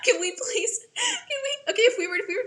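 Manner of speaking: high, breaking up with laughter; laughing while talking. Real, not acted. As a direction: try to speak through a fit of giggles. Cracking up at co-star's remark.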